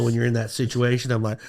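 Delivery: ordinary delivery, nothing marked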